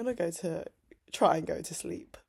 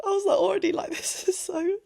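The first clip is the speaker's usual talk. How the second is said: overwhelmed gush; 12 semitones higher than usual. Real, not acted. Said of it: "And then at the end".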